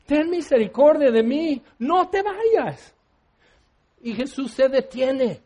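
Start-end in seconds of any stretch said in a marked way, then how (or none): 0.1-2.9 Bartimeo. Retold or imitated. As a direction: call out pleadingly, climbing higher. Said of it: "y él gritando". (imitando un clamor agudo y suplicante)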